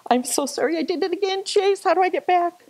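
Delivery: high, fast and flustered, apologizing to Chase; sorry, lost the slides, help me back; flustered apology. Real, not acted. Slideshow mistake, asks Chase for help.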